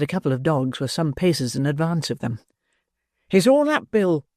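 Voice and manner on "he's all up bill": high, urgent shout to Bill; urgent cry. Acted. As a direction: cry out a frantic warning. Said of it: "cried Toby".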